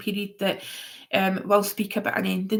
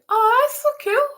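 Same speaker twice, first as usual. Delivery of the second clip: much higher and louder, thrilled; excited squeal; 6 dB louder than usual. Real, not acted. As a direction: gush with mock delight. Delighted at getting a troll.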